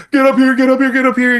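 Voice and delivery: very high, urgent mock-panicked call; panicked plea. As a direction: call your wife up in a high-pitched panic. Panicked phone call to wife.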